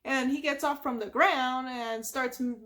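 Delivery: usual speaking voice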